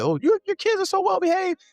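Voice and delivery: high, far-off mocking voice of an impressed parent; mock praise. Teasing how other parents praise his kids, in a high mocking voice. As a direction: put on a gushing visitor's voice, way up high.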